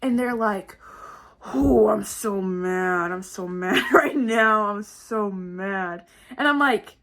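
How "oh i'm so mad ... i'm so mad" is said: low, drawn-out seething, imitated; mock menacing grumble, slow and low; angry woman in the ear. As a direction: draw it out slowly like someone grumbling into an ear. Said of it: "I hear some person in my ear".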